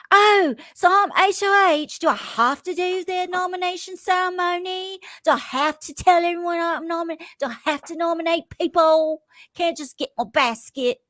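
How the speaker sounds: high-pitched Australian accent impression, playful; mock Australian accent. Mocking Bowie Jane's reluctance as HOH, Australian accent impression. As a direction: put on a teasing Australian accent. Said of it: "I don't know what accent that was".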